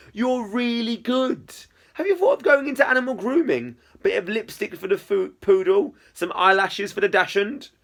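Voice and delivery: high-pitched, gushing friend; playful enthusiasm. Acted. Izzy praising friend's make-up skills, playful enthusiasm. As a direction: pitch it up and tease with delight.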